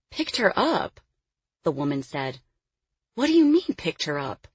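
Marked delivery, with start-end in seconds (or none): 0.1-1.0 high-pitched disbelieving question; incredulous echo. Acted. Woman echoes it in disbelief. 3.1-4.5 indignant disbelief. Acted. Woman challenges the men.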